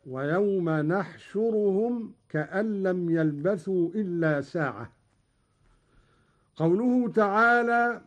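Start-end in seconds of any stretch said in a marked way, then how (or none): 0.1-4.9 نحشرهم opening with n in place of y; nun of majesty. Shown as a demonstration. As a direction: recite نحشرهم as nahshuruhum, with an initial nun. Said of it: "بنون العظمة هكذا". (قراءة نحشرهم بنون العظمة)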